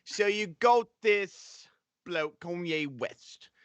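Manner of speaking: put-on a lightly done Cockney British accent. To the stream, telling the feud in a British accent. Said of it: "your quote-unquote British accent".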